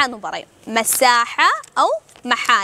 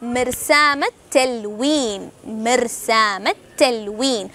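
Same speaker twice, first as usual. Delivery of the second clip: drawn out, word by word for learners; slow teaching repeat; 3 semitones lower, much slower than usual. Shown as a demonstration. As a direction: spell it out slowly so students catch it. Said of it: "എന്താ പറയാ?".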